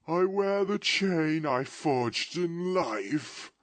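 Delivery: slow, high, loud mournful wail; ghost's voice. Acted. Ghost lamenting its fate to Scrooge in a slow wail. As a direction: lament your doom from the grave.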